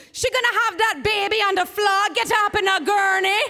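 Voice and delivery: put-on nurse with a high, loud island accent; urgent island nurse taking charge; Caribbean accent. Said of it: "she's from the islands".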